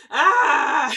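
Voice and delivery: sudden loud laugh into "ah"; delighted laughing cry. Real, not acted. Overwhelmed by fabric's beauty.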